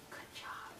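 breathy whispered good job; soft unvoiced pet praise. Real, not acted. Praising dog.

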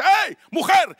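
loud, high scolding call; stern rebuke. Acted. Priest Elí scolds supposedly drunk woman, sharp shout. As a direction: bark at her to stop.

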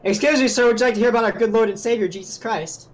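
mock missionary. Mock doorstep preacher bit.

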